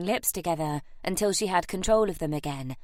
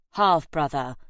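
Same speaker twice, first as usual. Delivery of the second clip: short, louder correction; snapped; 2 semitones lower, 5 dB louder than usual. A narrator's reading. Harry correcting his aunt, snapping. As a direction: snap the correction at her. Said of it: "Harry snapped".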